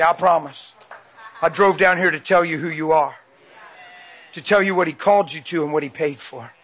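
ordinary talk, nothing marked

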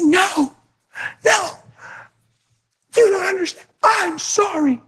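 a hint of crying insistence; tearful insistence. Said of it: "and she said".

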